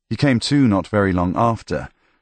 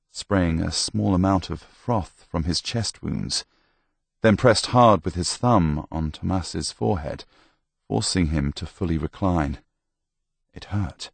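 plain reading voice

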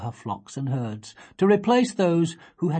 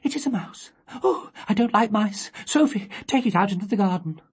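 mildly alarmed cry; 7 semitones higher than usual. Acted. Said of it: "She cried".

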